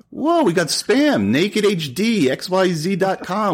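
high-pitched, drawn-out hype voice; mock excitement. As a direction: read it out slowly in a high, hammed-up thrill. Mock delight at spam comment.

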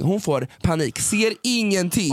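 high, slow, dramatic; excited retelling. Real, not acted. Telling friend she couldn't see, high and slow. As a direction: stretch it out in disbelief.

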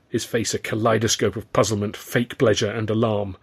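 plain reading voice

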